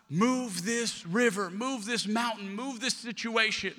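slow, drawn-out demanding prayer; slow pleading prayer. Acted. Believer at the riverbank begging God, slow plea. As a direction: stretch each move out like pleading. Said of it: "we go".

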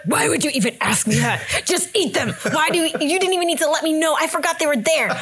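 playful retold telling-off; mock scolding. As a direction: scold in disbelief, half laughing. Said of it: "screaming out the arepas".

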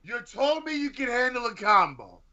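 high-pitched mock outrage; indignant accusation. Challenging co-host's boxing boast, high and indignant.

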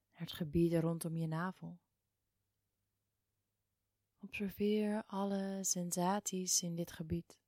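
ordinary talk

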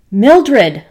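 loud falling shout of the wife's name; panicked full-voice shout. Acted. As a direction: call her across the yard in alarm. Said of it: "I shouted".

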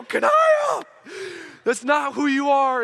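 put-on high, loud yell of a teacher calling out a kid; acted shout across a playground; exasperated shout. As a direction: holler the name, then insist loudly. Calling out across playground after punch.

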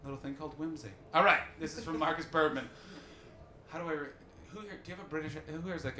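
normal talking voice

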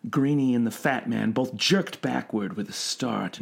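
plain reading voice

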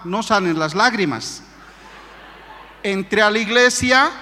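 ordinary talk

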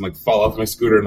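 ordinary delivery

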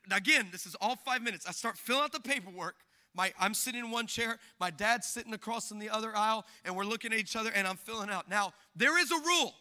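usual speaking voice

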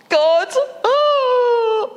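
a retold sob, voice cracking up high; replayed tearful wail, mostly acted; sobbing. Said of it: "I said between sobs".

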